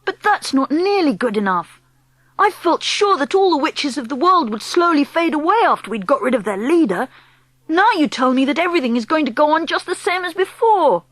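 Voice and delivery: a hint of an anxious child; upset young boy.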